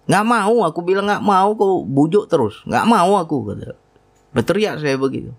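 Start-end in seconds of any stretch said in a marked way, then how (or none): 0.1-0.6 higher, mild retold shout; mock yell. Man shouting at his own urges, mild mock yell. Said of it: "Pernah saya berteriak". 1.0-3.5 higher, raised refusal; mock yell. Man refusing the voice inside, mild mock yell. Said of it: "Pernah saya berteriak".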